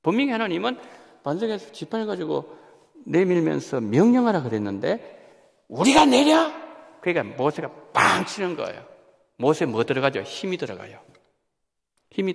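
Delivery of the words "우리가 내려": high, loud indignant retort; a preacher acting Moses's outburst; angry Moses. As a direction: fling the question out in anger. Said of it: "화가 나서".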